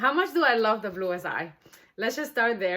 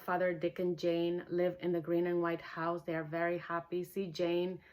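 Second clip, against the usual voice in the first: soft, running-together primer read; quiet, hurried storybook reading; fading primer reading; 6 dB quieter than usual. Acted. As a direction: let it fade, no pauses.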